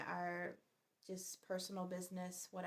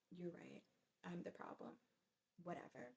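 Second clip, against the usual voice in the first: muttered sarcastic surrender; 12 dB quieter than usual. Retold or imitated.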